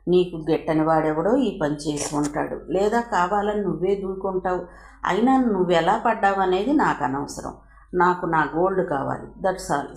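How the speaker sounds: ordinary delivery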